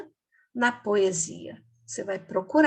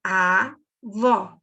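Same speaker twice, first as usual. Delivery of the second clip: "Avó" as two separate beats, A... VÓ; split syllables; 3 semitones lower, 4 dB louder than usual. Shown as a demonstration. Teacher showing pupils two syllables, "Avó" said split. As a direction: pause between A and VÓ in "Avó". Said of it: "essa palavra tem duas sílabas".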